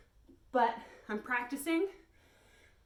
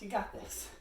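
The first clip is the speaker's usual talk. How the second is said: ordinary delivery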